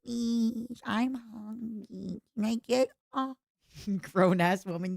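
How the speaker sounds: put-on slow, whiny baby voice begging for food. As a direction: play the needy baby of the house. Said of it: "I get to be like".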